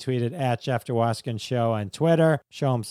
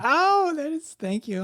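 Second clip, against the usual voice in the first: high, surprised oh; delighted surprise. Real, not acted. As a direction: caught off guard by a compliment. Flattered by guest's praise.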